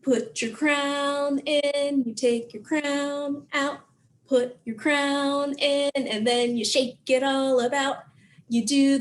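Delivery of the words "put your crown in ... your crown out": normal talking voice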